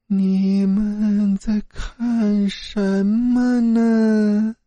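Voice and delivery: high, slow, drawn-out ghostly voice; a female ghost's eerie, drawn-out call; eerie ghost woman. A narrator's reading. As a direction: stretch every word like a floating spirit. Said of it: "一个女人的声音".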